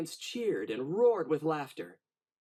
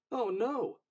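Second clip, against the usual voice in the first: higher, dismayed storyteller gasp; a hint of shocked dismay; 7 semitones higher than usual. A narrator's reading. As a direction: sound the alarm for the little listeners. Narrator reacts to the fall.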